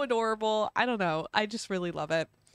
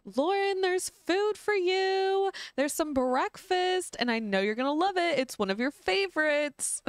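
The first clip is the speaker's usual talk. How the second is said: put-on high, sing-song call to a child; calling mom voice; 6 semitones higher than usual. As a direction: call her down to breakfast, sweetly. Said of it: "She's like".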